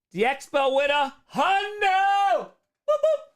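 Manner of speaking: shrill-high, slow, loud announcer yell; sudden high-pitched shout, stretched out; high yelled callout. Acted. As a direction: belt it out like a game show host. Man yells donation callout in story.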